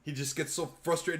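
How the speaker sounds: ordinary delivery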